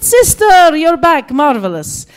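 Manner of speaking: loud, high delighted welcome; overjoyed, booming hello; jubilant greeting. Retold or imitated. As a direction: greet a long-lost friend at full volume. Acting her jubilant driver's greeting.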